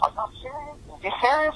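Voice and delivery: comic high-voiced retelling; high mock disbelief. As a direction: squeak out the disbelief. Disbelief retold.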